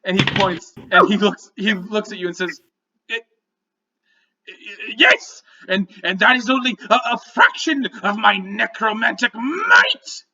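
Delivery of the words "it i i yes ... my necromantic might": high, stammering goblin voice; flustered goblin. Acted. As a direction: bluster to cover the embarrassment.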